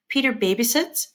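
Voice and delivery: ordinary talk, nothing marked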